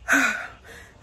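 big tired exhale after exercise; heavy exhale. Real, not acted. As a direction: let out a long breath. Panting after jumping jacks.

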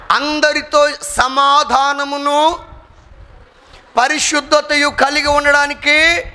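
booming pulpit call to make peace; fiery preaching. Acted. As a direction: preach it to the back row at full volume. Preaching at full force.

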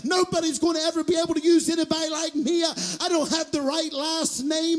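loud, high, rhythmic sermon shout; full-force preaching. Real, not acted. Preacher voicing the self-doubter then promising God's help, full-force preaching. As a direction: drive each phrase hard with a punched ending.

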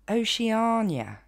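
Oceania à l'anglaise, lentement, « o-chi-A-nia »; prononciation anglaise. Shown as a demonstration. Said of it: "va se dire". (Oceania en anglais, articulé sans hâte)

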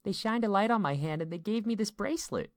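put-on high, eager child voice; excited little girl. A narrator's reading. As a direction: tell Dad excitedly as a small daughter. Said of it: "she sounded excited".

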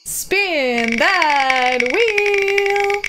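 long drawn-out game show call, high and loud; hyped announcer call. Acted. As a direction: stretch every word like a game show host. Hyping up the wheel spin.

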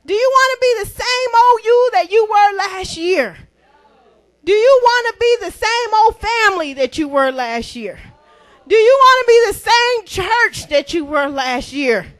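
preacher building to a shout; building preaching. Real, not acted. Building rhetorical questions to congregation.

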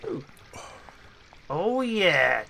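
usual speaking voice

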